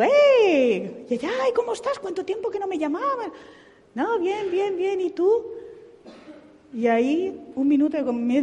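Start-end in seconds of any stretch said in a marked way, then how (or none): 0.0-3.3 mucho más aguda, contenta por la sorpresa; saludo alegre. Retold or imitated. Cheerful phone greeting retold. 3.9-5.4 más aguda, imitando a la amiga; respuesta de la amiga. Retold or imitated. Friend's reply on phone retold.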